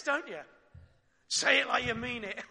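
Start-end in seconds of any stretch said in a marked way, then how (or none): 1.3-2.4 louder, higher, a parent's stern demand; exasperated parent. Retold or imitated. Parent scolding a child for a hollow apology, louder. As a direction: insist the child means it. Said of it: "And you know what comes next".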